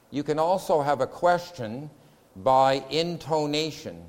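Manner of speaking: ordinary delivery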